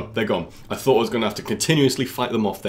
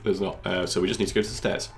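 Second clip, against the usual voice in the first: usual speaking voice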